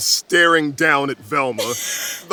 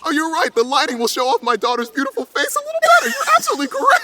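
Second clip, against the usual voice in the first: doting proud dad; 7 semitones higher, much faster than usual. Acted. Doting dad shows photos.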